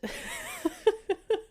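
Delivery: squeaky high laugh at herself; high giggle. Real, not acted. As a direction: burst into a giggle. Laughs at her own flub.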